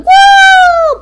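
high, loud, sustained woo; flying whoop. Pretend. Player acting out a leap at a guy, high whoop. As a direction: whoop as you launch through the air.

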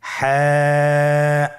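হ্যাঁ from mid-throat, held long; throaty ha. Shown as a demonstration. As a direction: squeeze the throat and draw হ্যাঁ out. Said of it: "আবার বলুন". (গলা চিপে হ্যাঁ লম্বা টেনে)

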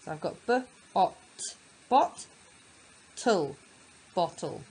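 b, ot, bot said as split sounds, then blended into bottle; sounding out. Shown as a demonstration. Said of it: "sounded out".

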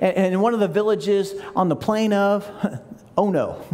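ordinary delivery

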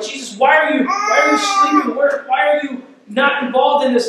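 high, crying shout of panic; panicked cry. Retold or imitated. Disciples in the storm crying out to Jesus, panicked. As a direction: cry out in terror to a sleeping Jesus.

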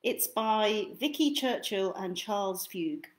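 ordinary delivery, nothing marked

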